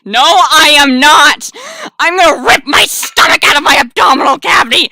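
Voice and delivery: hammed-up furious protest; outraged denial. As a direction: yell it in over-the-top outrage. Furious denial of butterflies in stomach.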